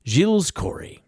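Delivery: normal talking voice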